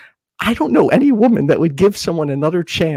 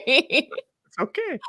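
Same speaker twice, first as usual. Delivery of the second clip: reassuring; 10 semitones higher, 4 dB quieter, much slower than usual. Pretend. Slow high reassurance.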